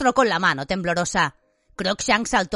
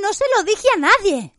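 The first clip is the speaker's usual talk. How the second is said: high, betrayed cry; indignant, pitched-up shout; shouted outburst; 12 semitones higher, 4 dB louder than usual. A narrator's reading. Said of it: "gritó Hermione".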